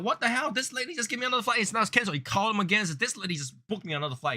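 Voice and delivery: high-pitched, indignant rant as an irate customer; mock outraged complaint, high and insistent; acted customer outrage. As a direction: imitate an exasperated caller, pitch jumping way up. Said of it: "you'll be like".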